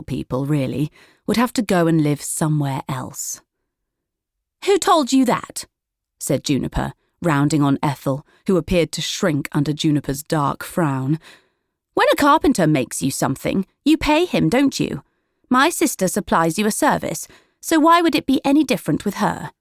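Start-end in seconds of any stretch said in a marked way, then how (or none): 4.6-5.6 higher, sharp challenge; indignant sister confronting Ethel; rounding sharply. A narrator's reading. 11.9-19.5 higher, pointed, speeding up at the end; sharp sister lecturing the village; argumentative sister. A narrator's reading. Juniper arguing for her sister.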